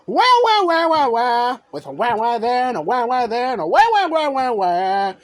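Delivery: put-on the captive girl wailing wah; mock crying wah. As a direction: bawl it like a cartoon baby. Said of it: "she cried".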